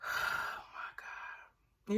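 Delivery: breathless whispered dismay. Real, not acted. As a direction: whisper it, stunned. Aghast reaction after a sigh.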